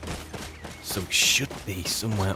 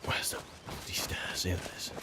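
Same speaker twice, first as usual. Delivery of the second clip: whispered; 12 dB quieter than usual. Real, not acted. Sneaking through a dungeon.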